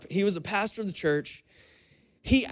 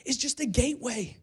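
raised, high preaching voice; 6 semitones higher than usual. Acted. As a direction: preach it with rising conviction. Preaching on eternal reward.

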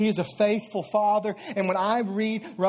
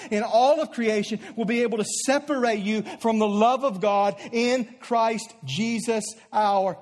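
rising, louder scripture reading; preaching; 5 semitones higher, 5 dB louder than usual. Acted. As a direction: proclaim it to the back pews. Scripture read at full preaching force.

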